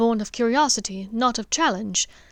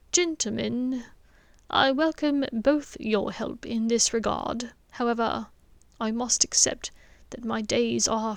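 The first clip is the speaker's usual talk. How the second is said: put-on soft, quiet old wizard's voice; quiet grave headmaster; 3 dB quieter than usual. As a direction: speak softly, a dying man asking for peace. Dumbledore speaks gravely.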